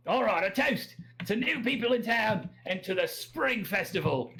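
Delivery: high, rousing Australian toast; Australian accent. Acted. Drunk local toasts newcomers at the festival, rousing Australian accent. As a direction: raise a toast like a rowdy Australian. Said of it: "that's my Australian accent".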